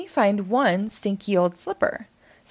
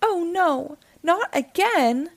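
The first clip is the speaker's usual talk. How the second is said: dismayed; 8 semitones higher, slower than usual. A narrator's reading. Cat dismayed.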